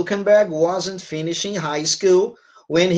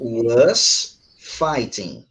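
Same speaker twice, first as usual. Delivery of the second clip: very slow and lower, word by word; slow correction; 4 semitones lower, much slower than usual. Shown as a demonstration. Teacher repeats the right verb form to student, slow correction. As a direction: stretch both words out for a learner.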